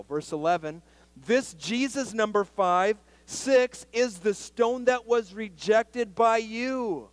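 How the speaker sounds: loud, high-pitched pulpit preaching; fiery preaching. Acted. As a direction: drive the point home to the church. Preaching scripture at full force.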